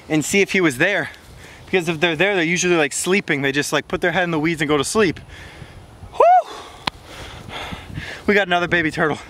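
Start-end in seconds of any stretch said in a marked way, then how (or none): 6.1-6.5 a sudden very high, loud whoop of joy; excited whoop. Real, not acted. Vlogger whooping at a new baby turtle, thrilled. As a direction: shout it out like you just struck gold.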